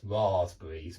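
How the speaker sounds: southern English long ah in "raspberries"; southern British pronunciation. Shown as a demonstration. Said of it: "In the south".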